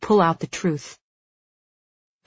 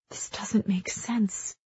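soft, slow murmur; quiet, puzzled muttering to oneself; murmur to self; 3 semitones higher, 8 dB quieter, slower than usual. A narrator's reading. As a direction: murmur it slowly under your breath. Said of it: "I murmur to myself".

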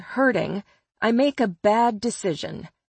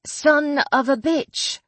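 fake British accent; 5 semitones higher than usual. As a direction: redo the curse deliberately in the fake British accent. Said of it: "I repeat, in accent".

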